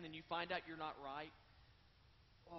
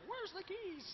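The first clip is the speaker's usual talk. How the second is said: mock screamed demand, pitched way up; screaming; slower than usual. Husband yelling down the phone at his wife. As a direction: shriek it like a furious husband.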